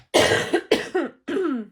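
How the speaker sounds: loud chesty cough; a real cough from a cold. Real, not acted. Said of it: "Sorry, guys, I got just this crud".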